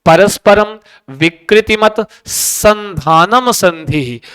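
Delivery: slow, measured Sanskrit sutra recital; slow rhythmic recitation of वर्णानाम परस्परम. Shown as a demonstration. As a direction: recite the rule like a classroom chant, word by word. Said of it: "तो कहते हैं".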